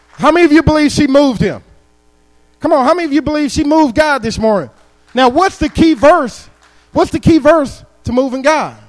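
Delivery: loud, high, ringing preacher's call to the crowd; preaching at full force. Acted. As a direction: preach it out and rouse the room. Preacher calls to congregation.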